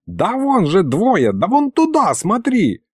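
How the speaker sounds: high, agitated grandpa; impatient pointing out. Acted. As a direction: steer his eyes there impatiently. Grandpa impatient boy cannot see.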